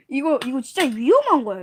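ordinary talk

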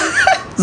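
a sudden squealing laugh; real laugh at the shy answer; high burst of laughter. Real, not acted. Mother laughs at reply.

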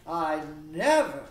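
"never" leaned on, loud and falling; stress on never. Shown as a demonstration. Hippolyta insists to Theseus, loud emphasis on "never". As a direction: punch the word "never". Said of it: "there's that word never again".

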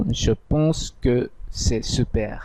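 each syllable of "Je pense que c'est super" drawn out very slowly; slow French demo. Shown as a demonstration. Teacher says the French sentence slowly to students. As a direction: say the French line slowly and clearly for learners. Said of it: "ফরাসির কাছাকাছি এক্সপ্রেশনটি হবে এরকম".

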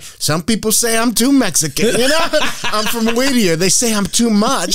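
imitated high voice, holding forth to the crowd; talkative Mexican woman. Woman addresses everyone in the stands, imitated high voice. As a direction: pitch it up like a tipsy fan sharing proudly. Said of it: "standing up talking to everyone".

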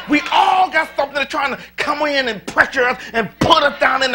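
loud, high preaching as God speaking; rising preacher's shout; full-force preaching. Acted. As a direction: preach it hard, God commanding the flock. Preaching climax to congregation.

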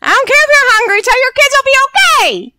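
fed-up player yelling at a sim who wants to eat; shrill exasperated yell. Acted. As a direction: shriek the command at her.

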